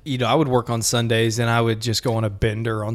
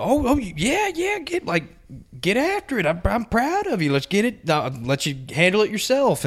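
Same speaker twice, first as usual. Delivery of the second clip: much higher, pumped-up boss voice; hyped boss; 7 semitones higher, 3 dB quieter than usual. Retold or imitated. Boss cheering on his young employee, pitched way up.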